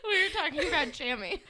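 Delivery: high voice breaking up with giggles; laughing. Real, not acted. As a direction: laugh right through the words. Both hosts laughing over fashion talk.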